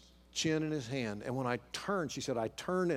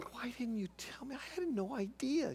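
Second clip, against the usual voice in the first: high-pitched, softly incredulous retold disbelief; soft, high-pitched protest of total surprise; astonished; 12 semitones higher, 5 dB quieter than usual. Husband to wife after her confession, astonished.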